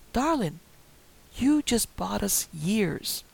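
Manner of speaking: slow, drawn-out doting line; old woman thanking her victim slowly; slow sinister sweetness. Acted. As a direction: savour each word like a gift. Alma's slow sinister gratitude.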